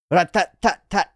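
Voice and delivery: rattling vocal gun burst; imitated gunfire. Imitating gunfire in manga action.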